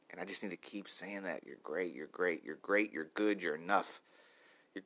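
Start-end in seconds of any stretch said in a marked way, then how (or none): 1.4-4.8 soft, murmured self-affirmation; murmured mantra. Shown as a demonstration. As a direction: whisper the mantra gently to yourself. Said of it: "That's the mantra for today".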